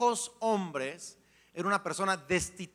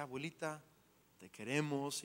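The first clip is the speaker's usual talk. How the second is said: soft farewell; 5 semitones lower, 10 dB quieter than usual. Retold or imitated. Speaking to grandmother at funeral.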